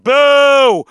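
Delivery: loud, high, drawn-out boo; playful jeering boo; mock boo. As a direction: boo it like a heckler in the crowd. Mock booing the child not being hurt.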